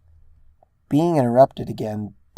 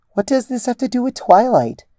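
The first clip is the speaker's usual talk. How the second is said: much higher, louder exasperated question; 13 semitones higher, 6 dB louder than usual. A narrator's reading. Rose presses Ditzy about Twilight, exasperated and louder. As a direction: demand an answer, fed up.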